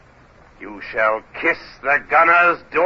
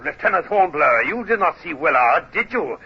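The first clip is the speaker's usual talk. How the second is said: ordinary talk, nothing marked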